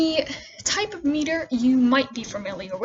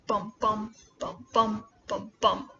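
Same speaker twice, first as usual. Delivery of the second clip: "bum, bum" repeated in pairs, second bum stressed; iambic beat; 3 dB quieter than usual. Shown as a demonstration. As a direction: tap out "bum, bum" pairs like a heartbeat. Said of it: "you'll have five of the".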